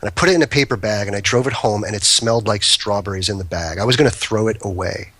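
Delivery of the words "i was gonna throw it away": ordinary talk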